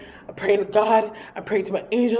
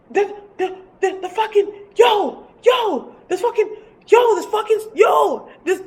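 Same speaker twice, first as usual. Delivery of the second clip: high, frantic false starts; panicked stammering; 11 semitones higher, 3 dB louder than usual. Retold or imitated. Storyteller acting out telling her boy, stammering in panic. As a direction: stammer it high, unable to get it out.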